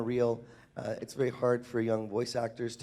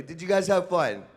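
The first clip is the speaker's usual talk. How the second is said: higher and louder, pumping the crowd up; louder, more enthusiastic; 6 semitones higher, 6 dB louder than usual. Shown as a demonstration. Host asking the crowd to answer louder, rousing call. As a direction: ask it again with real energy. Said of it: "You're gonna have to do better".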